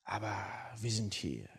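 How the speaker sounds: quiet, grim murmur; grim relatives. Retold or imitated. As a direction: mutter it like a disapproving clan. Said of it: "so nach dem Motto".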